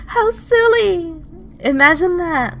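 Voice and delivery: high cackling giggle, slow and shrill; yandere laugh. Acted. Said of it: "a yandere laugh?".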